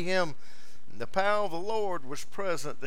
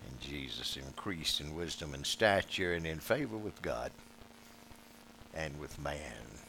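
much lower and softer, drawn-in storytelling voice; hushed sermon reading; 12 semitones lower, 10 dB quieter than usual. Acted. Preacher hushing the congregation to tell of the boy Jesus, hushed. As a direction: lean in and tell it almost under the breath.

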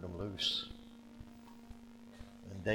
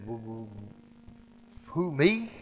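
stuttering; 6 dB louder than usual. Acted. Moses answering God, mock stutter. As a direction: stammer out the question. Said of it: "Moses stuttered".

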